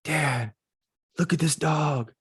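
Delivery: put-on a small boy tugging at his dad, quietly; lightly voiced kid, hushed and eager; son's plea. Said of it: "he goes".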